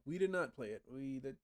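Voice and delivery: usual speaking voice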